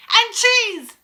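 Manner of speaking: high, loud, dramatic exclamation; witch's shout. A narrator's reading. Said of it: "shouted the Grand High Witch".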